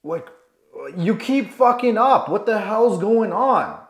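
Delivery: high, loud, slow exasperated scolding; acted angry father losing patience; frustrated dad. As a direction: demand answers from a hopeless son.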